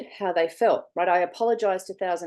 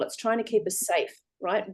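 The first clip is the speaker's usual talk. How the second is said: ordinary talk, nothing marked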